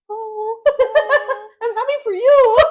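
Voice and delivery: very high, drawn-out strained cheer, laughing; mock happy congratulations. Telling her excited friend she's happy, mock cheery. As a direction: squeal a forced congratulations through laughter. Feigning joy at friend's news.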